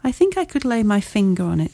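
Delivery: plain reading voice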